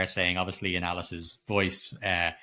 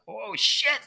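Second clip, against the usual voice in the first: higher, sudden mock alarm; jolted viewer's startled outburst; shocked exclamation; 13 semitones higher than usual. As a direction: blurt it out like you've just seen something creepy start. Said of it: "You're like".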